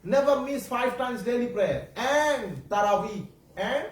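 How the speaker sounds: ordinary talk, nothing marked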